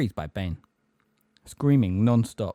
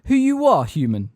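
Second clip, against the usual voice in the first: raised, forceful demand; rough demand; 9 semitones higher, 7 dB louder than usual. A narrator's reading. Magorian to Umbridge at wandpoint, rough demand. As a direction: throw the question at an inferior. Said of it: "said Megorian roughly".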